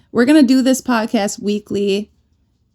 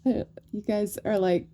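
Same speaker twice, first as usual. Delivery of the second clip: quiet laughing; 3 dB quieter than usual. Real, not acted.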